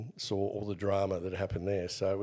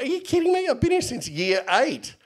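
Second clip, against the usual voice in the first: high-pitched disbelieving yelp, louder; incredulous outrage; 7 dB louder than usual. Retold or imitated. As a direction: squeal it out in shocked disbelief. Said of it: "I'm like".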